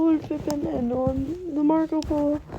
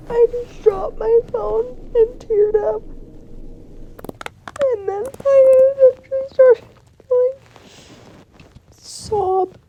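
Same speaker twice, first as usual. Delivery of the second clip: crying; 7 semitones higher than usual. Real, not acted. Remembering dropping her phone, breaking into sobs. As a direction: voice cracking high and rising into a sob.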